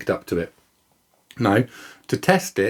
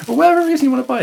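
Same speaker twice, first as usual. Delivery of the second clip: fast, much higher and louder, still laughing; 5 dB louder, much faster than usual. Real, not acted. Brushing off the lazy-buyer joke, laughing fast. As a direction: rattle it off through the giggle.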